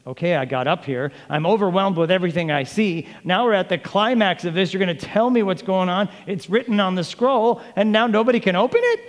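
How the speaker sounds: mounting mock indignation; overwhelmed protest. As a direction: build from level talk to a high, incredulous complaint. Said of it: "He's like".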